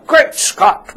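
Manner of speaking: slow, hammed-up British accent on "great Scott"; a bad stab at a posh British "great Scott"; terrible British accent. Host mocking himself with a terrible British accent. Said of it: "that was my British accent. It was terrible".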